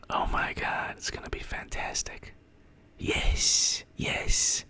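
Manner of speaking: barely audible, voiceless whisper; awed whisper. Real, not acted. Streamer savouring a hoped-for outcome, hushed gleeful whisper. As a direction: whisper it to yourself in disbelief. Awed private celebration of the news.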